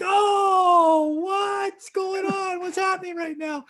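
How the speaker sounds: high, drawn-out mock wail; re-enacted screaming. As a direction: stretch the words into a panicked mock scream. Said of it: "And I'm screaming".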